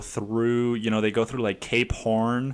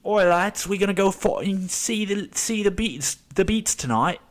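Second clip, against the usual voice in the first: put-on British accent; 8 semitones higher than usual. Teenage lad inviting friends to a Beatles concert, British accent. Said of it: "and just like".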